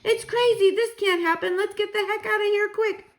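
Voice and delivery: high, loud panicked shout; panicked shouting men. Acted. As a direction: yell it as you flee the room. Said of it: "men were ... shouting".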